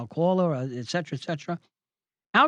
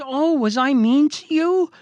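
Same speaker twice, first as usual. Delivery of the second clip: high, loud, drawn-out mock concern; slow, sing-song sarcasm; 9 semitones higher, 5 dB louder than usual. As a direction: throw the bully's whine back at him. Mocking comeback to the bully.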